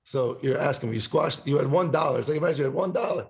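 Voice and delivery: normal talking voice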